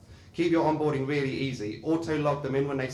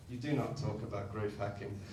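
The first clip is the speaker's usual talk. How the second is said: hushed; 4 semitones lower, 8 dB quieter than usual. Acted. Fight Club rule quoted.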